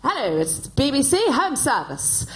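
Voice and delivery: put-on slow, plummy mid-century BBC announcer accent; mock received-pronunciation BBC radio voice, drawn out; old BBC announcer. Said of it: "learn so that you could say".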